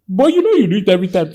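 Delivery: loud, high, rapid accusation; heated accusation. Retold or imitated. Husband arguing with wife in a retold quarrel, heated accusation.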